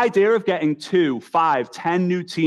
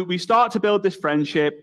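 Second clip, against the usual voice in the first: normal talking voice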